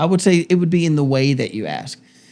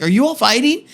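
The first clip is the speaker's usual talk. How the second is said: put-on much higher, rising childlike question; high squeaky kid checking on the parents; kids asking; 11 semitones higher, 3 dB louder than usual. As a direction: play the worried kid in the back. Daughters asking from the back seat.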